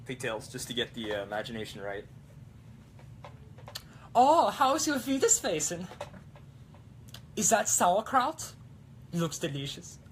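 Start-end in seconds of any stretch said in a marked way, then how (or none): 4.2-8.5 put-on very high, louder German girl accent; a squeaky, hammed-up girl with a German accent; German girl impression. Taking a viewer's request, he plays a high, hammed-up German girl. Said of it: "German girl".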